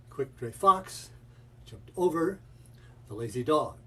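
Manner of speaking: FOX, OVER and DOG squeezed out hard, pauses between; stressed syllables punched. Shown as a demonstration. Teacher showing Fight Club drill, "fox", "over", "dog" punched. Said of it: "stressing the stress syllable".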